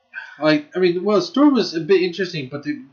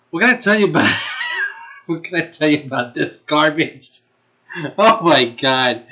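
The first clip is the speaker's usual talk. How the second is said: helpless giggles between the phrases; real laughter. Real, not acted. Vlogger trying to describe a terrible show, laughing. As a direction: break down laughing mid-question. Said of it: "I'm laughing because this is".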